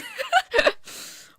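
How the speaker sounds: a loud, high laugh that tips toward crying; laughing until it almost breaks; high helpless laugh. Real, not acted. Cracking up at the fact.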